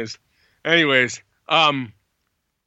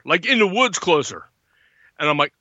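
normal talking voice

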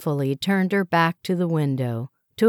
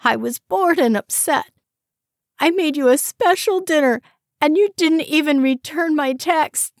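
higher, louder, hurt; upset accusation; 11 semitones higher, 4 dB louder than usual. A narrator's reading. Wife reproaching husband for missed dinner, upset. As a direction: lay the blame on him.

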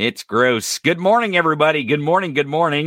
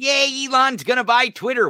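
much higher squeal of mock glee; mock cheering fan; 10 semitones higher than usual. Host mocking fans cheering Elon buying Twitter, high mock squeal. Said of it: "yelling and screaming".

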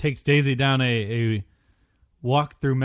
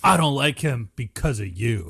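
bayou swamp man. Pretend. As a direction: play a swamp local from the bayou. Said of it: "the guy from the bayou".

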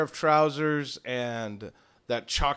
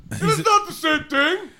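much higher and louder; indignant declaration; 9 dB louder than usual. Acted. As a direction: insist with wounded pride. Paladin argues with player.

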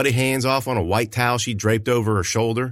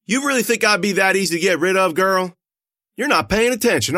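taunting; 9 semitones higher than usual. Acted. Taunting the girl with gun.

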